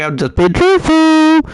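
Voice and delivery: high loud squeaky take; mock high squeal. Repeats line in two ways.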